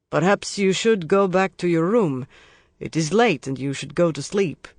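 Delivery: a hint of stiff security guard; stiffly firm, lightly voiced guard. A narrator's reading. Security guard telling the drunk to leave, stiff. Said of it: "he says stiffly".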